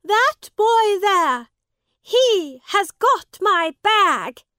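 high, loud, slow accusation; woman accusing the boy loudly. A narrator's reading. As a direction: denounce him to the crowd.

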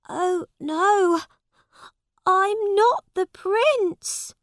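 put-on a frightened boy cries out, slow on "not"; Tom pleading. A narrator's reading. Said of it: "cried Tom on his knees".

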